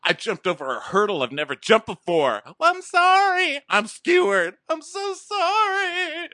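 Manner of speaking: loud, high wailing apology; hammed-up tearful outburst; mock heartbreak. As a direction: wail it, dragging out the last words. Acting out a shattered man's outburst.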